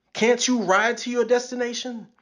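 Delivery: usual speaking voice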